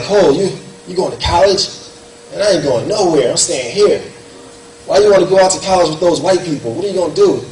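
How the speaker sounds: a hint of scoffing buddies; mocking friends. Friends mock his college plans.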